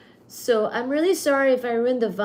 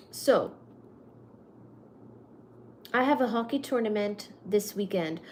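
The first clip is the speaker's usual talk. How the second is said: ordinary talk, nothing marked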